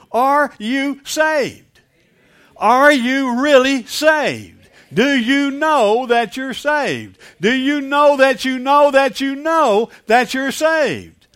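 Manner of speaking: loud, high, drawn-out challenge; impassioned preaching. Real, not acted. Preacher challenging the congregation on salvation, loud and impassioned. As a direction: press each question slowly at full force.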